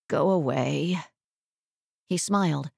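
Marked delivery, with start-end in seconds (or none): 0.1-1.0 low croaked dismissal; weak croak. A narrator's reading. Sick woman sending visitor off, low croak. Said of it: "and she croaked".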